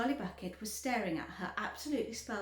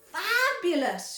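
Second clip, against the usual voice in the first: high, slow excited shout; 9 semitones higher, 9 dB louder, slower than usual. A narrator's reading. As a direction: cry it out in delight. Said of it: "shouted Violet".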